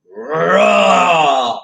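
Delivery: long loud monster roar; bear roar. Acted. The story's bear roars at the child, loud roar. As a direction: bellow out a big bear roar.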